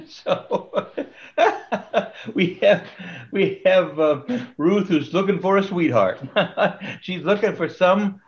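real laughter breaking into the words; laughing. Real, not acted. Teacher resuming the lesson after the sweetheart joke, laughing.

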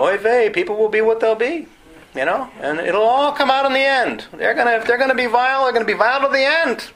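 put-on Yiddish-accented oy-vey resignation; Yiddish-flavoured voice.

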